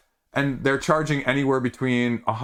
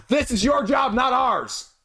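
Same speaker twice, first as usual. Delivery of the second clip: high-pitched indignant protest; hammed-up indignation; mock outburst; 12 semitones higher than usual. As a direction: raise the pitch sharply and protest in exasperation. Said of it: "part of me says".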